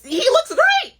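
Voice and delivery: high, loud gushing praise; squealing over how great he looks; mock gushing. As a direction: rave about him like a fan. Mock admiring a guy online.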